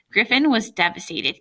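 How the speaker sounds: usual speaking voice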